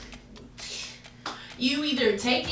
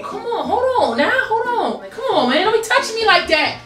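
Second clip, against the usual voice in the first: high, loud protest through laughter; mock outrage; 8 semitones higher, 4 dB louder than usual. Boy-character fends off his friend's touching, laughing loudly. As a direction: shove her off, half laughing.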